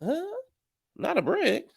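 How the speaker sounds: a confused high double-take; baffled disbelief. Retold or imitated. The whole group reacting to the brick claim, stunned high disbelief. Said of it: "we was all like".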